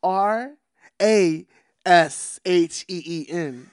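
loud, deliberate letter-by-letter spelling; slow, enunciated spelling. Shown as a demonstration. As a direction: spell it out slowly so everyone gets it. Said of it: "I gotta enunciate. I gotta slow it down".

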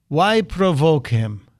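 slow bad Russian accent; Vladimir Putin voice. Host quoting Putin's shower answer, slow bad Russian accent. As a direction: draw it out in a mock Russian accent. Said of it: "again in my bad Putin voice".

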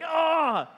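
high mock yelp of pain; pained cry. Dad with wrist caught in the car, mock pained cry. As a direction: act out a sudden high "ah".

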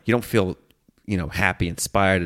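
usual speaking voice

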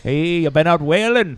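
salty Maine whaler; Maine accent. Acted. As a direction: call out in a put-on Maine accent. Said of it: "What do they sound like?".

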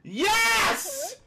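high, loud yell of delight; triumphant shout. Real, not acted. Host crowing over the answer, triumphant shout.

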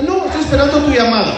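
high, loud indignant retort; exasperated sarcasm. Acted. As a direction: throw it back at the caller, high and loud. Sarcastic reply to late-night caller. (sarcasmo indignado, agudo y fuerte)

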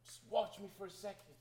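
high, loud pleading to the computer; in-character plea. Acted. Player character stalling the computer, urgent high plea. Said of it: "to go in and say".

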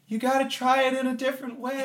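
high, put-on helpful voice; mock actress hint. Escape room actress hinting at the lock, high mock voice. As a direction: imitate the scared guide giving a clue. Mimicking actress giving hints.

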